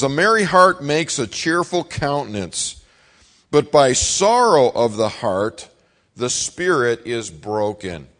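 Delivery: slow, weighty scripture read; solemn Bible reading. Acted. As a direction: read the verse slowly and solemnly.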